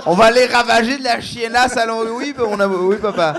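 high, loud put-on French accent; phone call with father. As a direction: act out a French-accented call to dad, loudly. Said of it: "je parle à mes parents". (accent français exagéré au téléphone avec papa)

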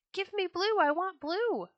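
high, loud piping little bird; piping bluebird. A narrator's reading. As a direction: pipe it up high and eager. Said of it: "piped the bluebird".

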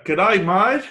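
mock Australian greeting, admittedly botched; bad Australian accent. Australian accent tried on a mock buyer. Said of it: "can't do the accent".

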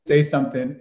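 normal talking voice

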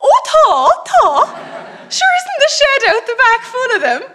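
put-on high, loud old man's voice with a laugh; old neighbour's voice laughing. Old neighbour remembers the shed full of crosses, laughing. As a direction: mimic him delighted at the memory.

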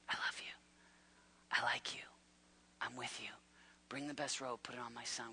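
soft, hushed voice of a loving father; tender whisper. Acted. God reassuring his broken son, in a soft tender whisper. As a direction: whisper the love like a secret.

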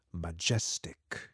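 usual speaking voice

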